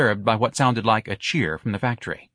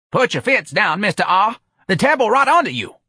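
high, loud, exasperated worker; shouted, effortful reassurance; straining lifter; 12 semitones higher, 5 dB louder than usual. Acted. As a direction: urge him down while straining.

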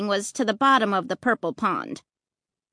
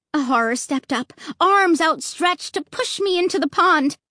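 ordinary delivery

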